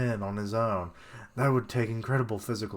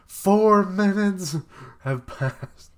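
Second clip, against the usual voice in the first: "Four minutes" bellowed much higher and louder, stretched very slow; big dramatic shout; 9 semitones higher, 8 dB louder, much slower than usual. Shown as a demonstration. Reader rereading the time with a stunned, slow shout. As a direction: announce it like a stunned crowd. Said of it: "rereads the panel as a big slow shout".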